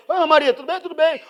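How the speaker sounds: high, bright greeting at a reunion; mock-cheerful churchgoer; cheerful greeter.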